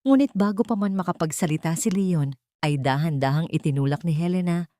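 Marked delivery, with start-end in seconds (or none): none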